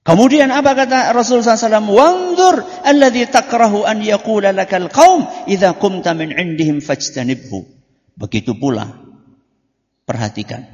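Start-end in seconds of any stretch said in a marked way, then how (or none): 0.1-1.9 raised preaching voice. Acted. Preacher asks class loudly. 1.9-7.7 hadith recitation. Acted. Arabic hadith quote recited.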